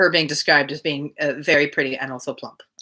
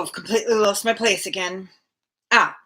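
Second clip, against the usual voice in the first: ordinary delivery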